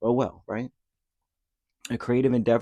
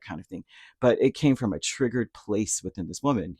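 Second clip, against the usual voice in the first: ordinary talk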